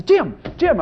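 high, urgent name-calling; imitated excited wife. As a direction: act out a wife waking her husband. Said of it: "She'll wake up and say".

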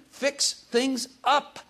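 high, slow mock demand; demanding prayer. Preacher retelling his prayer to God, high slow demand. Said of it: "I've told him".

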